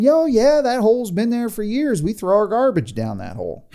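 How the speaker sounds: high-pitched Southern neighbor, easing down; hammed-up Southern local; Southern accent. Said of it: "why they have Southern accents".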